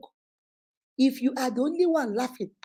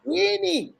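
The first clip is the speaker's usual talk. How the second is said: high, falling call of a name; 7 semitones higher than usual. Real, not acted. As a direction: call the name to rouse a silent guest. Calling a guest who doesn't answer.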